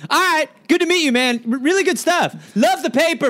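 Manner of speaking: butter him up to get away; hasty flattery. Acted. Crowd member meeting feared Benjamin, hasty flattery.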